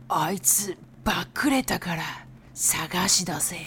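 low hushed gangster threat; menacing yakuza whisper. Acted. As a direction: whisper it like a mob boss. Yakuza example line read out.

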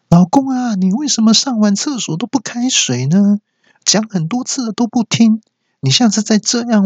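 high sing-song wife nagging her husband. Acted. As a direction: whine the nag like a sweetly menacing wife. (妻子尖声撒娇式地碎念老公)

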